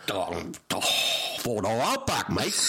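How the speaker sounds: hammed-up Aussie accent; Australian accent. Mock Australian answering about his car, hammed-up Aussie accent. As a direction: play the joke Australian. Playing an Australian being interviewed.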